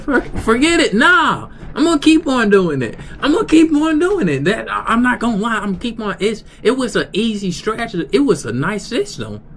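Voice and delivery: squeaky high defiant insistence. Retold or imitated. Defiantly refusing his friend.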